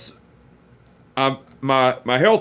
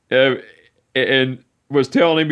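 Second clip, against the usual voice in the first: ordinary delivery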